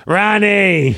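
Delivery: high bossy shout of a name; mock toddler yell. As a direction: bark it like a little dictator. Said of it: "she's barking orders at me".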